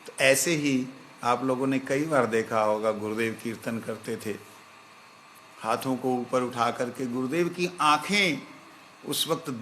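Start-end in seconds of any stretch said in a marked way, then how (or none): none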